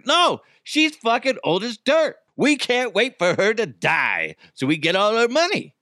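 high-pitched, hammed-up angry relatives; exaggerated indignant outburst; mock irate family. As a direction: imitate a nasty family protesting in a high voice. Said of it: "her whole family was making her feel like shit".

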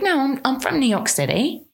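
playful fake British accent; put-on British accent. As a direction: claim New York in a mock British accent. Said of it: "if I just had an accent".

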